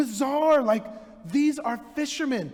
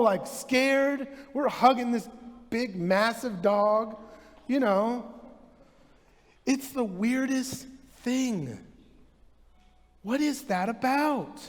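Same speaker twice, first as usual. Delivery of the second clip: ordinary delivery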